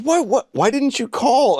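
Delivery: he can't believe you showed up; astonished. Retold or imitated. Astonished friend at the door.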